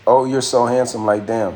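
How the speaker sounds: ordinary talk, nothing marked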